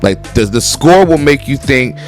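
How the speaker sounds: usual speaking voice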